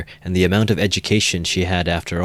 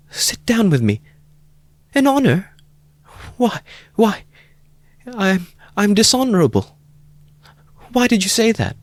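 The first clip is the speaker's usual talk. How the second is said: put-on high voice of a distressed young woman; Sonia, distressed. A narrator's reading. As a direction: stammer out her shame. Sonia's voice in the story.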